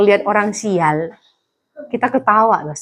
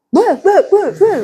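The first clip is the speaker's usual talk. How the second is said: mock yelling; 10 semitones higher, 3 dB louder than usual. Mock yelling at deaf chickens.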